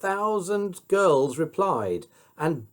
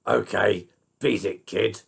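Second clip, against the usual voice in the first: mild gruff murmur; 5 semitones lower than usual. A narrator's reading. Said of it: "He murmured".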